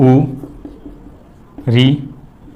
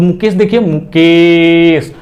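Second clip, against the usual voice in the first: calling from afar; slower than usual. Shown as a demonstration. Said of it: "जैसे कोई दूर है उसको बुला रहे हैं".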